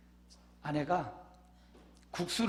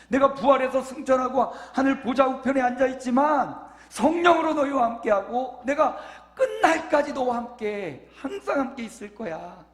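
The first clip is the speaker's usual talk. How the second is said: louder, higher ringing sermon voice; full-force preaching; 8 semitones higher, 8 dB louder than usual. Acted. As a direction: proclaim the promise to the whole church. (힘차게 외치는 설교)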